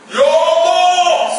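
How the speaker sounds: long, high, loud wail to God; hammed-up wailing plea; imitated tearful wail. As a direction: cry out to God, stretching the words. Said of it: "dia teriak".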